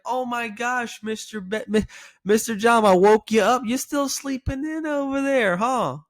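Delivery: high, slow teasing voice; playful teasing. Shown as a demonstration. Agent teasing the sleepy caller, high and playful. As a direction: tease him like an old friend. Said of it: "selecting a playful tonality".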